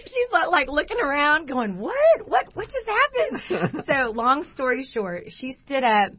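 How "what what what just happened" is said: high-pitched mock astonishment; bewildered. Startled friend looking around, retold.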